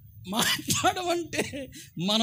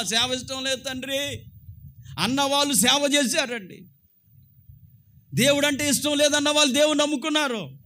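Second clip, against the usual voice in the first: loud, raised preaching voice; fiery preaching; 5 semitones higher, 6 dB louder than usual. Acted. Preacher urging listeners to serve God anyway, loud. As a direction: drive each line home to the crowd.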